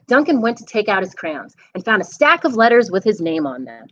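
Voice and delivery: usual speaking voice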